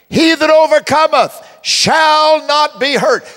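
loud, slow, high proclamation; full-force preacher declaring scripture; preaching at full force. Acted. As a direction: proclaim the verse to the crowd. Preaching the verse.

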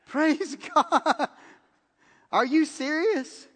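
high, slow, laughing praise and disbelief; thrilled, can't believe the gift; overjoyed disbelief. Retold or imitated. To the friend giving him her car, high thrilled disbelief.

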